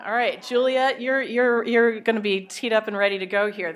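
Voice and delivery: ordinary talk, nothing marked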